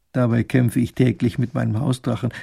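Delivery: usual speaking voice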